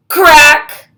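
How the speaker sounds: loud, sudden sound-word; loud crack. Acted. Sound of glasses breaking.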